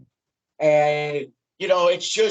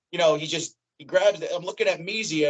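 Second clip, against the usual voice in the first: ordinary talk, nothing marked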